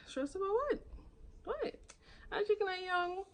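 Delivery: very high, incredulous squeal of a question; retold disbelief, pitch leaping up. Patient disputing a stress diagnosis, incredulous.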